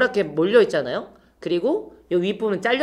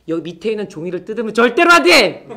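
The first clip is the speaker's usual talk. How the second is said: emphatic warning; 6 dB louder than usual. Acted. Teacher warning kids emphatically.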